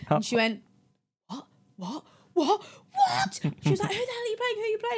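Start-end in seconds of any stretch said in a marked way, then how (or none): 1.3-3.4 each repeat climbs, ending in a squeaked mock shriek; escalating disbelief. Friend hears the casting news, escalating disbelief. 3.9-4.9 high, softened squeal of disbelief; excited demand. Retold or imitated. Friend asks her which role she got, excited demand.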